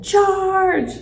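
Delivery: high, drawn-out charging cry; battle yell. A narrator's reading. Hamster runs at the food bag, yelling. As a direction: yell it like leading an attack.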